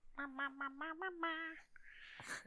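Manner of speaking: high sung "ma-ma-ma" notes; soft high-pitched musical vocalizing; wordless singing. Real, not acted. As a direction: trill a repeated high note softly. Sings wordless tune after song title.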